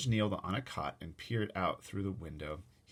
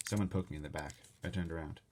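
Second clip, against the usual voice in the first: ordinary delivery, nothing marked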